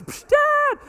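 put-on very high, loud child wail; crying child calling. Daughter being hit calls her father, high wailing. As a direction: shriek for Dad to help.

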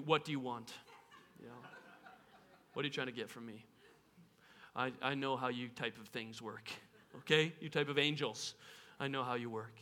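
low and soft, wary; Mary distrusting the angel's flattery; suspicious. Pretend.

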